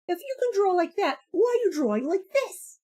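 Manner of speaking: high mock disbelief; retelling the critic's baffled question, pitched high; incredulous. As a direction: demand it like it makes no sense.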